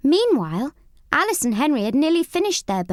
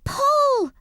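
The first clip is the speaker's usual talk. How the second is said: high, loud scream; a frightened mouse screaming to his friend; 14 semitones higher, 3 dB louder than usual. A narrator's reading. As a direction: scream the order. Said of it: "screamed Sammy".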